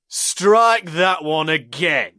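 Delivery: loud, slow, high amused order; amused command. A narrator's reading. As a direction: command it, enjoying the cruelty. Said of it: "commanded with an amused tone to his gruff voice".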